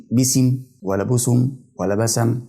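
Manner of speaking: "باسم ولا بسم ولا بسم" as bisim, busum, basam; wrong vowels on seen. Shown as a demonstration. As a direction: add a vowel after each s in "بسم": i, u, a. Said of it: "فما قلتش".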